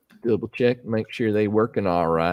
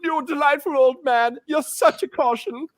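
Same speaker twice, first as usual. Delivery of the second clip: much higher and louder, hammed-up fawning cry; mock gushing flattery; 5 dB louder, slower than usual. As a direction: overplay being fooled, sarcastic delight. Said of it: "what am I supposed to say?".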